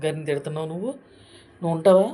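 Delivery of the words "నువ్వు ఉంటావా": loud, furious challenge; scolding a YouTuber who mocks women, angry shout. Real, not acted. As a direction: yell it at him.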